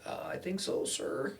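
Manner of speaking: high, soft, hesitant whispery answer; timid reply. Retold or imitated. Nervous student answering an angry cop, timid. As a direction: answer meekly in a small high voice.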